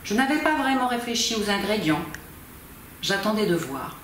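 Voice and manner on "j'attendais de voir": plain reading voice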